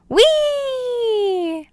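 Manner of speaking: long high squeal of joy; gleeful acorn on a squirrel ride; excited whee. A narrator's reading. Thrill of the climb.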